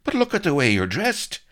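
high, loud exclamation; incredulous. A narrator's reading. Blood gaping at Vanity's undress, loud and high. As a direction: point at her outfit in disbelief.

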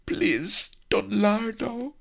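high, timid plea; high, weak maiden's plea. Acted. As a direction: begin faintly, afraid. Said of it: "she began weakly".

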